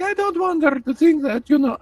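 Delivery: put-on very high, muddled Italian-Russian accent; old Italian-Russian woman. Mom defends herself to other parent, Italian-Russian accent. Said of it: "old Italian woman who was raised in Russia".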